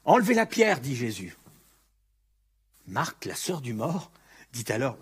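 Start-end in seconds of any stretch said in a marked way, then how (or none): 0.0-0.8 loud, high command; commanding call. Acted. Jesus ordering the crowd at the tomb, loud command. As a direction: call out the order so all can hear.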